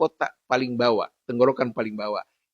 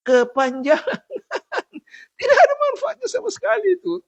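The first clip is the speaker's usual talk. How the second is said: high-pitched, laughing through the words; 4 dB louder than usual. Real, not acted. Teacher mocking overlong recitation, laughing high voice. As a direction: break into laughter and talk on high.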